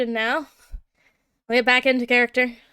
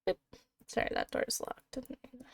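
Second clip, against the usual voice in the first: whispered aside; 16 dB quieter than usual. Real, not acted. GM mutters aside at a mistake.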